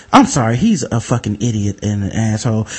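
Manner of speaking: low, apologetic manager imitation. Manager apologizing to the customer, low and placating. Manager voiced in retold story.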